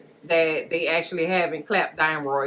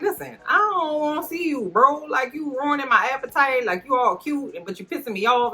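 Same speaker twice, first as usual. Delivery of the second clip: higher, mock irritated brush-off; annoyed queen; 8 semitones higher than usual. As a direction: tell him off like a cranky young queen. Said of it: "She's like".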